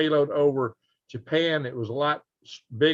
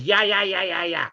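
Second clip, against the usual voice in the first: lightly mocking chatter, higher pitched; imitating blaring web page; 4 semitones higher than usual. Host mocking a page that talks when opened, irritated imitation. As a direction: rattle it off like an autoplay video. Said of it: "open a page and it goes".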